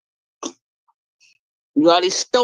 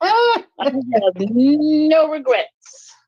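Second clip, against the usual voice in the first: laughing; 8 semitones higher, much slower than usual. Real, not acted. Gleeful after divorce song.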